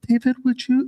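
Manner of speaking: put-on high-pitched, submissive girlfriend voice; soft feminine girlfriend. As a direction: imitate a timid girl asking sweetly. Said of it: "She's so feminine".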